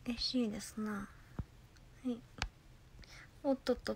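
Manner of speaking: soft happy whisper. Real, not acted. Thanking chat in a soft whisper. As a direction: whisper your joy.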